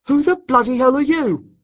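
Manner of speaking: high, loud shocked demand; outraged shout. A narrator's reading. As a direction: bark it at the intruder. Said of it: "Jake asked the intruder".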